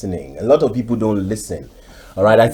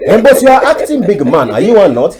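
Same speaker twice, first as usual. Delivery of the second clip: much higher and louder ranting Nigerian elder, breaking into laughs; mock outraged elder; 10 semitones higher, 7 dB louder than usual. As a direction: rant the list of diseases like an old man in a comic radio drama. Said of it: "And the guy will say".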